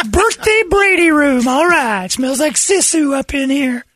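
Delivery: high, slow, loud whoop; mock party yell. Joking about last night at Brady's house, whooping. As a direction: holler it in a hammed-up high voice.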